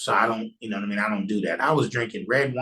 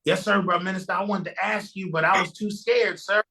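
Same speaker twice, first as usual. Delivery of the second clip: mock eager-to-please self; 7 semitones higher than usual. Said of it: "Here, here go me".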